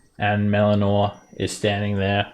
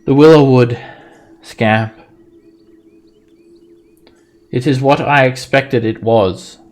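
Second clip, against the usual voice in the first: loud, higher, solemn spirit voice; forest spirit; 3 semitones higher, 8 dB louder than usual. Acted. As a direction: speak like an ancient sorrowful tree god. GM voices the tree demigod.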